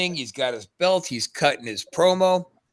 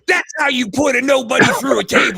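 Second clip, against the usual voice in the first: loud, high triumphant shout; retold shout; 9 semitones higher, 8 dB louder than usual. Wrestler gloating over a beaten rival, shouting loud and high. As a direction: yell it over the crowd in triumph. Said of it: "And I said".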